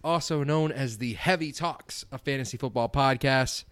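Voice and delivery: high, upbeat show opener; energetic podcast welcome; podcast host intro. Acted.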